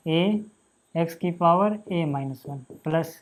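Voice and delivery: very slow board dictation. Shown as a demonstration. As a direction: drag each word out while writing it on the board. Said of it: "teacher dictating slowly".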